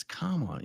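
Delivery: "Kamala" stressed on the first syllable; corrected name. Shown as a demonstration. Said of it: "Marilyn says it's Kamala".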